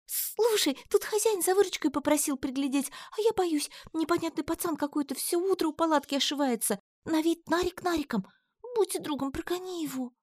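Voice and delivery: put-on young market girl, hurried and scared; Алька, worried chatter. A narrator's reading. Алька begs Татьяна to chase off a junkie, anxious high voice.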